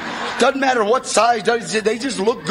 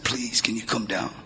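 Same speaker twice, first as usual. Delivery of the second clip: low, much softer pleading; a retold desperate plea, softer and lower; 11 semitones lower, 7 dB quieter than usual. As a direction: beg quietly, worn down. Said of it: "with that desperation".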